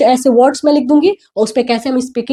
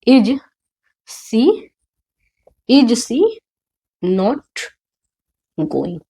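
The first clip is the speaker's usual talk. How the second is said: slow dictation; much slower than usual. Shown as a demonstration. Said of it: "interrogative बनाना है".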